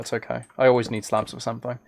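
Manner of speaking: ordinary delivery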